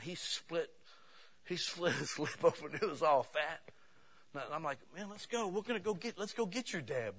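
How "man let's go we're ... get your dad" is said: acted urging. Speaker rallying his friend to go get the dad, acted urging. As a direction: urge a friend along, pitched up high.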